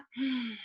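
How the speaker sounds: slow audible exhale; long breath out. Shown as a demonstration. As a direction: let the breath out with a sigh. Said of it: "Breathe out".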